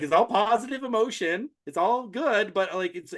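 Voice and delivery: high, upbeat self-quote; mock cheerful. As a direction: play it off as all positive. Retold own emotional state.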